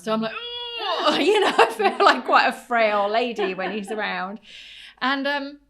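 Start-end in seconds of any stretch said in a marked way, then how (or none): 0.3-4.4 laughing groan. Real, not acted. Mock groan under son's weight.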